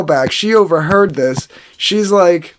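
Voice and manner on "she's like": ordinary talk, nothing marked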